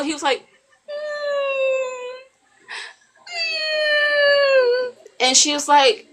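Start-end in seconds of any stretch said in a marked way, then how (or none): 0.9-4.9 very high, drawn-out squeal; imitated pig squeal. Man forced at gunpoint to squeal, imitated pig squeal. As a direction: squeal like a pig, badly faked. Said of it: "he started to squill".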